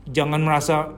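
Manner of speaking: usual speaking voice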